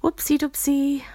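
high, playful campy squeal; campy drag queen. Acted. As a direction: camp it up. Playful exclamation.

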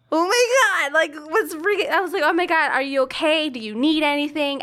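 high, loud panicked questions; retold freak-out. Sister reacting to news of a first period, freaking out. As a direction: re-enact freaking out, pitch jumping up. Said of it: "So I was, like, freaking out".

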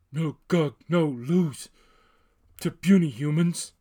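loud dim-witted ogre; booming ogre voice. A narrator's reading. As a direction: bellow it like a dumb brute. Ogre speaks in broken speech.

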